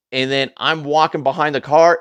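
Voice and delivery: usual speaking voice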